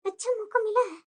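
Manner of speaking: hushed, plotting to herself; quiet scheming aside. Acted. New bride plotting to herself, hushed scheming. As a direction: murmur the plan so the family can't hear.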